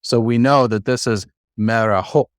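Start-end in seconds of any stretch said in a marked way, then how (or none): 1.6-2.2 "meraho" with the stress on the last syllable, ho; stress on final syllable. Shown as a demonstration. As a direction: land on the closing "ho" of "meraho". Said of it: "where to put the emphasis".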